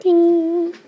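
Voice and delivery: a sudden very high sung "ding" like a game-show bell; ping it out like a quiz bell marking a right answer; mock bell ding. Lecturer rings a mock bell after the class joke, high ding.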